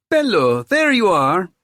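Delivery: high, loud and delighted welcome; effusive greeting. A narrator's reading. Theo rushes to greet his friend, effusive. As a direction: cry out to a long-awaited friend.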